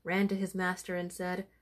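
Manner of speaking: ordinary delivery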